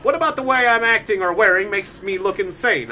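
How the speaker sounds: loud, high-pitched protest of sanity; mock indignation. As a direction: protest loudly, playing the offended madman. Mock protest at being called insane.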